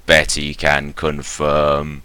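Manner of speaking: slow, level robot delivery; drawn-out syllables on one note; monotone robot voice. Acted. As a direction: intone it like a machine reporting. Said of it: "monotone robot voice".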